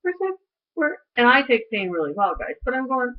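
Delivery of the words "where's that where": lightly voiced whiny child, much higher. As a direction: ask like an impatient little kid. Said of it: "so I'm like a child".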